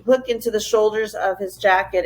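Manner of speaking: ordinary delivery, nothing marked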